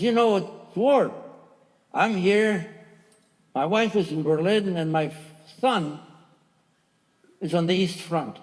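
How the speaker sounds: acted indignation, easing towards the end; retold anger. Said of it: "And he got mad".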